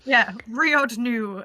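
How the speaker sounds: "Riod Nu" said slowly as reversed sounds; backwards speech. Shown as a demonstration. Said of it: "like, backwards".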